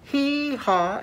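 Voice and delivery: high hee-haw whoop; cowboy hee-haw. Shown as a demonstration. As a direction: call it out for the class to copy. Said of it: "Can you do that?".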